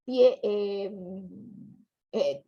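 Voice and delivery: ordinary delivery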